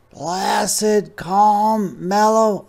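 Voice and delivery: high, slow, drawn-out sloth voice; unhurried sloth. Acted. Sloth voiced in read story.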